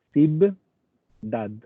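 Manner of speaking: "ciudad" split in two, "cib... dad"; lectura por sílabas. Shown as a demonstration. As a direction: sound out "ciudad" slowly with a b and a break. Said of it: "y después viene la B larga". ("ciudad" separado: "cib... dad")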